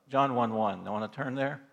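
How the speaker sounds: ordinary talk, nothing marked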